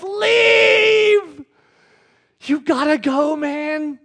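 high, loud, drawn-out shout; acted anguished wail; anguished cry. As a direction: cry it out in desperation from your knees. Acts Peter begging Jesus to leave.

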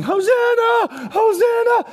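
high drawn-out Hosanna; loud, slow, ringing Hosanna; crowd shouting. Retold or imitated. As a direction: call it out joyfully.

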